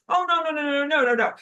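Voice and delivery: high, loud string of no's; sassy loud no no no; emphatic refusal. Acted. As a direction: shut it down with attitude. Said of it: "human attitude".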